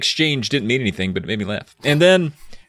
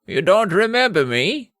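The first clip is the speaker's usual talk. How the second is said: put-on British old man; 10 semitones higher than usual. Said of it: "let's try to do British again".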